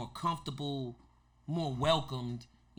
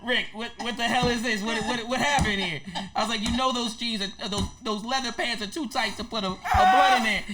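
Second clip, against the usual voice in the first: higher, louder, laughing exasperation; mock dismay; 5 semitones higher, 6 dB louder than usual. Youngster confronting Rick over broken blunt, laughing mock dismay. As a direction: question him in comic disbelief.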